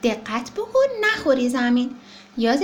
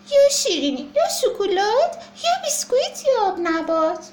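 put-on high, pleading child voice; whiny demand; 7 semitones higher, 4 dB louder than usual. Baby elephant demanding sweets from his mother. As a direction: whine out the list.

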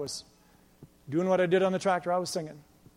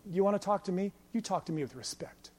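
calm; 5 dB quieter than usual. Retold or imitated. Young worker copying the boss's answer, calm and soft. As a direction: keep it quiet and controlled. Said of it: "in calmness".

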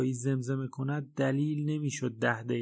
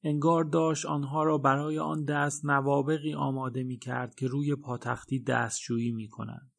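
plain reading voice

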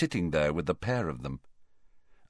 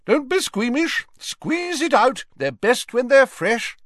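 much higher, louder called-out teacher voice; Professor Sprout calling out; 4 dB louder than usual. A narrator's reading. Professor Sprout calling to her class, loud and high. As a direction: call out briskly like a jolly herbology teacher. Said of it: "called Professor Sprout".

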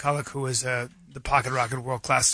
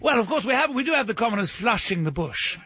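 haughty English aristocrat, higher pitch; mock English accent; 3 semitones higher than usual. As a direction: put on a snooty English hunter. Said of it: "Is that why you're doing the accent?".

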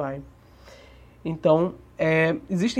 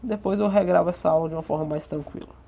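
quick hurried aside; rushing before cutoff; faster than usual. Real, not acted. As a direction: hurry it out. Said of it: "eu peço desculpa pela pressa".

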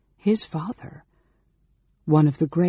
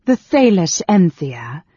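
slow, loud, incredulous query; loud disbelief, drawn out; scornful echo; 3 semitones higher, 8 dB louder, slower than usual. A narrator's reading. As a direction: throw the name back with scorn. Queen scornfully echoes the senator.

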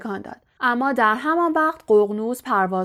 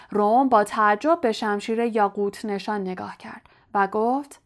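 plain reading voice

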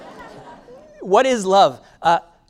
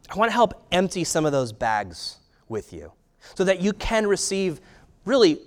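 ordinary delivery

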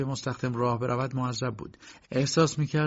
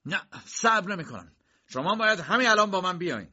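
high, agitated raised voice; heated, impatient insistence; insistent demand; 8 semitones higher, 3 dB louder than usual. A narrator's reading. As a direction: refuse and demand at once. K insists loudly, girl hears him.

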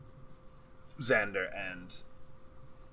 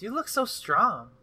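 flirty barmaid voice; 10 semitones higher than usual. Acted.

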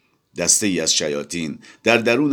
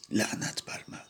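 muttered under breath; 12 dB quieter than usual. A narrator's reading. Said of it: "زیر لب گفت".

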